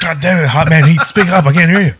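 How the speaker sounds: hammed-up irritated demand to speak up; exasperated. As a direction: complain loudly that you can't hear. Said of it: "has to speak very lightly".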